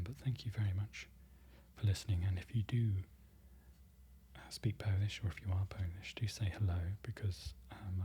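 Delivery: hushed farewell to viewers; ASMR whisper. Acted. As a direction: whisper your goodbye gently to the listener. ASMR outro, whispered thanks.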